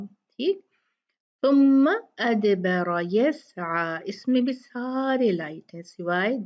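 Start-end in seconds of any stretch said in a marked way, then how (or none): 1.4-4.0 slow melodic recitation; slow Quranic recitation. Shown as a demonstration. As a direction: chant the verse slowly. Recites the verse.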